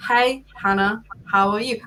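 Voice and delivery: plodding, spaced-out greeting; slow talker imitation. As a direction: greet them one slow word at a time. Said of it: "Just imagine that conversation".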